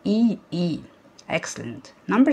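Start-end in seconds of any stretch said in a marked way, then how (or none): 0.1-0.8 "اي اي" spelled out letter by letter; name each letter of "اي اي" distinctly. Shown as a demonstration. Teacher spelling the two letters for pupils, letter by letter. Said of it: "ايه ال two letters اللي فيه؟".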